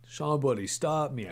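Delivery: usual speaking voice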